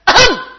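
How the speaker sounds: high, loud little fake cough; imitating a baby's ahem. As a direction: mimic the baby prompting grandad. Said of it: "he's going".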